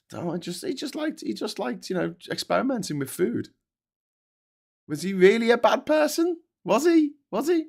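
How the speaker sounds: high, sarcastic mock-innocent questioning; mock defence. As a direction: pretend to defend him with fake innocence. Sarcastically excusing Jeffrey Dahmer.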